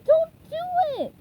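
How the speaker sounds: very high, loud, slow plea; desperate cry. A narrator's reading. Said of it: "he cried".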